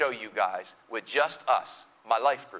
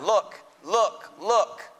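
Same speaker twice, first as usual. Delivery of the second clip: mild mock yell; 6 semitones higher, much slower than usual. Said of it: "he's yelling".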